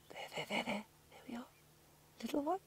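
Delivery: cooing softly. Real, not acted. Guide cooing softly at the cub peeking out, tender high coo.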